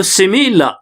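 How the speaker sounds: teacher's two-beat "simi-la"; two-part word. Shown as a demonstration. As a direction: stretch similar into two parts, "simi" then "la". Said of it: "टू syllable का word है".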